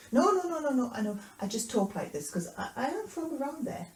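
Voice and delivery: loud high denial settling into stammered explanation; protest, then fumble for an excuse; flustered excuse. Acted. Peter's flustered excuse, acted.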